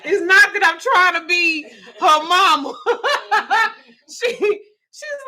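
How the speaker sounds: high laughing talk through giggles; giggling while telling it. Real, not acted.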